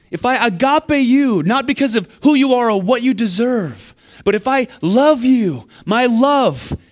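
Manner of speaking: high, loud, impassioned preaching; full-force sermon peak. Acted. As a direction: build to a fervent climax, pressing every line. Preaching climax on assurance.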